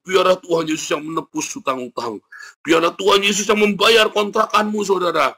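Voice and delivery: high-pitched, sustained intoning; mock pastor. Preacher mimicking a pastor promising paid rent, high intoning. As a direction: parody a pastor's lilting blessing. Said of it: "Pendetanya sambil membawakan".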